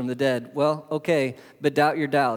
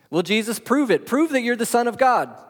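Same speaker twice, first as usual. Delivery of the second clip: a demanding doubter, pitched up; challenging skeptic; 7 semitones higher than usual. Retold or imitated. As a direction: throw down the challenge: prove it.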